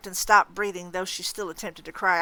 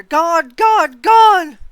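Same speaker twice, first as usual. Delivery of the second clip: each gone higher and louder, to a full shriek; building scream; 10 semitones higher, 18 dB louder, much slower than usual. Acted. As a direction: scream it up to a roar. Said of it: "She threw back her head and screamed".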